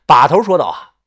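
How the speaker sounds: ordinary delivery, nothing marked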